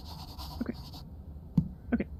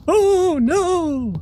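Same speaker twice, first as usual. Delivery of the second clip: loud, high panicked oh no; startled yelp of fear; frightened cry; 8 semitones higher, 9 dB louder than usual. Real, not acted. Player jumping at a scare, frightened loud cry. Jump scare in horror game.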